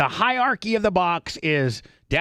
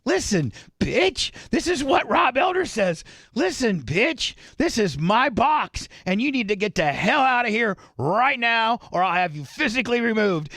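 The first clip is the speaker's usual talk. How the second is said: mock tough-guy warning from a little man; imitating a furious box owner throwing someone out; threatening tirade; 8 semitones higher than usual. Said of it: "he goes".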